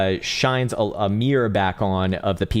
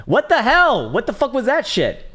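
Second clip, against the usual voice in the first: much higher, louder disbelieving yell; incredulous outburst; 4 dB louder than usual. Real, not acted. Ranting to chat about sitcoms, incredulous yell. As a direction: blow up at how unfunny it is.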